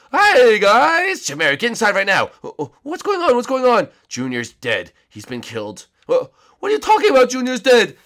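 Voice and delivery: high, loud cheerful chef. Acted. Chef arriving and greeting the guys in a high, loud voice. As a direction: squeak it out brightly.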